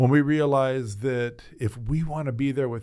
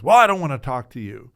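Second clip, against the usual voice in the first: much louder, a curt brush-off; a sick woman telling the pastor to leave; loud refusal; 11 dB louder than usual. Retold or imitated. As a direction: shut him out.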